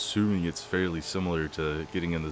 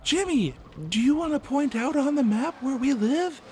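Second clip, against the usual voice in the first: much higher sing-song teacher voice; mock teacher; 4 dB louder than usual. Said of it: "where it's like".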